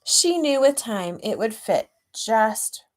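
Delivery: storybook reading; story narration. A narrator's reading. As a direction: read it like a picture book to a class. Reads picture book aloud.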